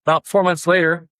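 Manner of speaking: usual speaking voice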